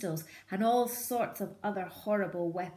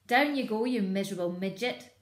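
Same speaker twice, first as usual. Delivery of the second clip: louder, scornful order; sneering order; 3 semitones higher, 6 dB louder, faster than usual. Acted. Bean ordering Bunce down, sneering. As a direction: sneer at him. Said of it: "said Bean".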